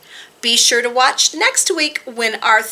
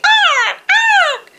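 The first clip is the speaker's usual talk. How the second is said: very high, loud bird squawks; screaming jays; 6 dB louder than usual. A narrator's reading. Narrator voices jays disturbing Owl, high loud screams. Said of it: "and the jays screamed".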